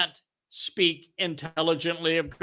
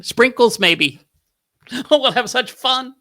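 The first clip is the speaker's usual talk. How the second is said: higher and louder, hammed-up sugary glee; mock glee; 7 semitones higher, 6 dB louder than usual. Preacher mocking a sweet gentle message with hammed-up glee. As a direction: gush like it's a sweet party.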